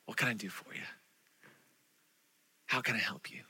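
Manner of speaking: soft, low and tender; gentle. Acted. Jesus quietly answering the blind man, soft and tender. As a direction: speak it gently, almost whispered.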